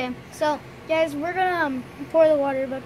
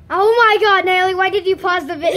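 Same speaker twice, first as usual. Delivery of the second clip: exasperated exclaim; 3 semitones higher, 9 dB louder than usual. Real, not acted. Video paused by mistake.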